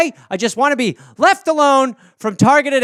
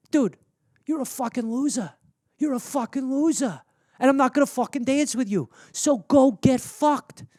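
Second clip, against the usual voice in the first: quiet tough-guy contempt; 3 semitones higher, 7 dB quieter than usual. Acted. Jersey dude dismisses alien.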